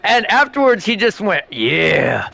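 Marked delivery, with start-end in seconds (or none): 1.5-2.3 deadpan tough guy. Retold or imitated. Imitating tough guy's reply.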